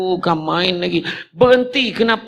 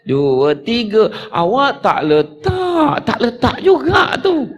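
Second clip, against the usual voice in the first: much higher, slow, crying whine; hammed-up tearful girlfriend; whiny lover; 8 semitones higher, slower than usual. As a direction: wail that they didn't hang up. Lover won't hang up.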